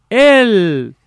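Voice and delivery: high, drawn-out distant call; echo. Acted. Voice answering from the other side, echoing call. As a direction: stretch it out like an echo, falling at the end. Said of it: "el eco es todo".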